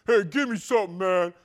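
loud, demanding mock teen; a hammed-up rude fourteen-year-old; rude teenager. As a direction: bark it like a sulky teen.

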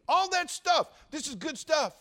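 jumps high and loud; a sudden high, loud preacher's cheer; excited exclamation. Real, not acted. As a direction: burst out with delight.

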